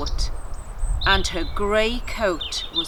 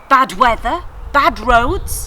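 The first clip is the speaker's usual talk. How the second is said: eager guesses; 4 semitones higher, 9 dB louder, slower than usual. Acted.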